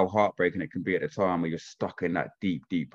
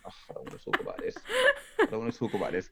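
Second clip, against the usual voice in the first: breathy, laughing high reluctance; laughing whine; 3 semitones higher, 4 dB quieter, faster than usual. Retold or imitated. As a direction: beg off the talk while laughing. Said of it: "I'm like".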